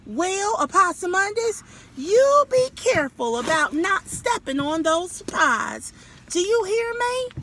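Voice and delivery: high, firm mother's warning; warning mama. Acted. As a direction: warn him up high like a mama. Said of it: "she said".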